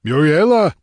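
loud, high-pitched call for someone missing; sudden shout. A narrator's reading. Man calling for the vanished girl, shouting suddenly. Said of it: "he shouted suddenly".

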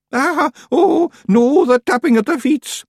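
high, loud mock wail of dread; panicked wail. A Feegle wailing at the tapping of the feet, panicked wail. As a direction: groan it out in comic horror.

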